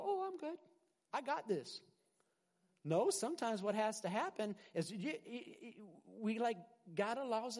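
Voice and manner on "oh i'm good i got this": high-pitched breezy self-assurance; mock overconfidence. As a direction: toss it off like you need no help. Mimics overconfident believer brushing off discipline.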